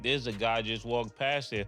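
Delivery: ordinary talk, nothing marked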